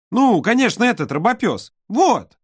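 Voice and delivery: put-on high, eager child; little girl voice. A narrator's reading. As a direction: show off toys like a little girl. Olka's voice.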